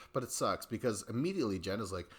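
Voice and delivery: usual speaking voice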